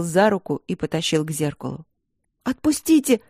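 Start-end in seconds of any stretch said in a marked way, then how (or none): 2.4-3.2 high, loud cry to let go; shout. A narrator's reading. Princess cries out as he drags her, high shout. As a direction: scream to be released. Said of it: "крикнула принцесса".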